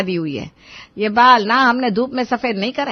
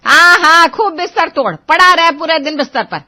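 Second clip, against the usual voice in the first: loud sarcastic scolding; angry mother; 5 semitones higher, 8 dB louder than usual. Acted. Mother grumbling at her son while leaving, loud sarcasm. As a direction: fling sarcasm at him as she storms off.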